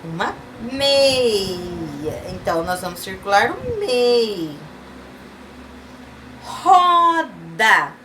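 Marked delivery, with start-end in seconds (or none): 0.7-2.2 very slow, stretched word; drawn-out syllables. Shown as a demonstration. Teacher confirms the answer for children, syllables drawn out. 6.4-7.9 high, stretched syllables. Shown as a demonstration. Teacher naming a picture for children, syllable by syllable.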